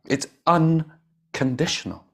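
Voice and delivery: "unconditional" slow and high, broken after "un"; emphatic insistence. Real, not acted. Teacher hammering home Buddha's love, slow "unconditional". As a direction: spell out "un ... conditional" with a pause. Teacher repeats word slowly, split.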